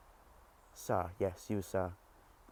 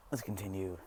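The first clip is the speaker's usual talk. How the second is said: normal talking voice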